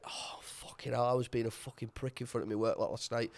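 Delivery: soft, low, half-voiced morning groan; groggy hungover mumble. Hungover man waking up regretting last night, soft low mumble.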